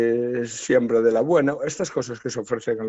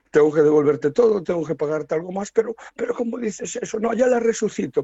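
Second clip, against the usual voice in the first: agitated exchange; 7 semitones higher, faster than usual. Retold or imitated. Son and king in quick exchange.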